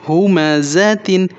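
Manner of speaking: slow, drawn-out ہومزتن with each syllable stretched; slow recitation. Shown as a demonstration. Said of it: "وقف دوران کیا پڑھیں گے؟". (آہستہ کھینچ کر ہومزتن)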